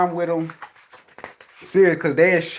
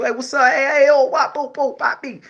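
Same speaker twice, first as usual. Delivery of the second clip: higher, bouncy and playful; playful, higher greeting; goofy friendly greeting; 11 semitones higher, 4 dB louder than usual. Pretend. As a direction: hype it like an easygoing guy. Acting out his friendly self.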